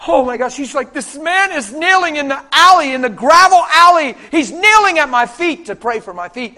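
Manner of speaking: loud, high-pitched astonishment; imitated overwhelmed woman. Woman amazed a man kneels in the alley, overwhelmed cry. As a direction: act out her amazement, voice soaring and loud. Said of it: "she was overwhelmed and humbled".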